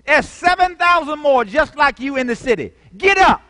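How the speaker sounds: God's rebuke. Acted. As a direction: bark the command at Elijah. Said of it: "God said, in my words".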